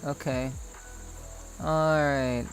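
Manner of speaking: ordinary talk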